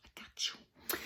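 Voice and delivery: whispered assurance; hushed, whispery confirmation; conspiratorial whisper. Acted. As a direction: whisper it like a secret promise. Repeats reassurance, dropping to whisper.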